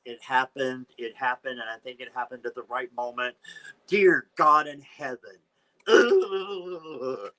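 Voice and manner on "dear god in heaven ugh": higher, louder "dear God" into a crying ugh; real fright and disgust, a crying groan; horrified groan. Real, not acted. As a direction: recoil from the card with a groan. Disgusted by possum card.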